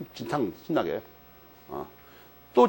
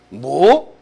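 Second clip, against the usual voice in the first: sudden loud startled "뭐?"; startled friend realizing the catch; surprised what; 4 semitones lower, 7 dB louder than usual. Retold or imitated. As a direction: blurt it out, caught off guard. Friend reacts to age nineteen.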